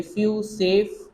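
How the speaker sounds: फ्यू, सेफ drawn out slowly to show the f; slow teacher demo of the f in फ्यू, सेफ. Shown as a demonstration. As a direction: stretch फ्यू and सेफ, leaning on the f. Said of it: "एफ की sound".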